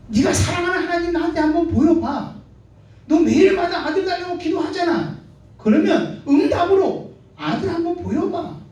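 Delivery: mocking rival Peninnah. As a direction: sneer it out, daring her to prove God. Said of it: "분인나가 한나에게 이렇게 말합니다".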